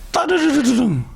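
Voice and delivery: high trilled telephone ring; spoken ringtone; phone ring imitation.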